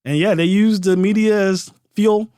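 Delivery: ordinary talk, nothing marked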